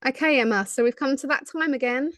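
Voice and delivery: ordinary talk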